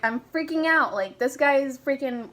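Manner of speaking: high, loud, panicky outburst; worked-up retelling of freaking out; retold freak-out. Retold panicking to her sister.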